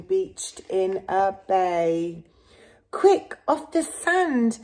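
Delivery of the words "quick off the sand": higher, urgent call; urgent cry. Acted. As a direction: hurry the whale back to the sea. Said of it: "cried the snail".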